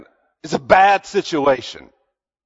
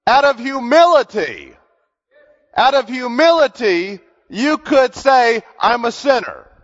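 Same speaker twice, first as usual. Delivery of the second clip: raised, slow preaching voice; 6 semitones higher than usual. Acted. Preacher urging the congregation to repent, in full sermon voice. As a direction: drive each point home to the congregation.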